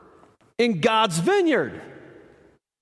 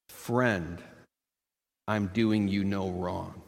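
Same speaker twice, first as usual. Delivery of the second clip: gentle master; 11 semitones lower, 5 dB quieter than usual. Acted. Vineyard master calmly answering a jealous worker, low and soft. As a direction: answer the grumbler quietly, like a patient master.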